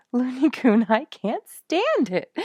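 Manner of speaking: high, loud laughing cry; laughing delight. A narrator's reading. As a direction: laugh through the words, helpless with delight. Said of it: "Ginny laughed".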